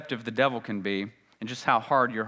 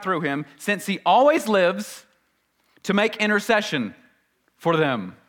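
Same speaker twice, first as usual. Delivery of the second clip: raised, ringing scripture read; 8 semitones higher, 6 dB louder than usual. Acted. Preacher reading Hebrews to the congregation, proclaiming. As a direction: proclaim the verse to the room.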